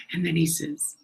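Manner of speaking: normal talking voice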